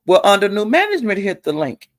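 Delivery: ordinary talk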